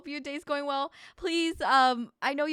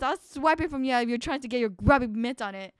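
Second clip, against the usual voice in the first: ordinary talk, nothing marked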